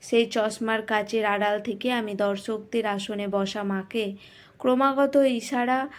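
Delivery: ordinary delivery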